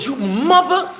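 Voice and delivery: retold blow-up at the cop over the dog; losing it. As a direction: start to curse, then choke it off. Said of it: "I'm losing it now".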